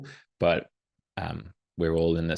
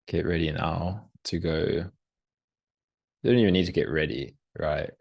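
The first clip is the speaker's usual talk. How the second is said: guided meditation. Acted. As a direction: lead them gently into the moment, unhurried.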